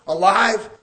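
ordinary delivery

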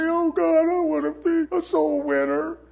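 lightly voiced fake-pious believer; mock pious plea. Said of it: "you're conning him when you say".

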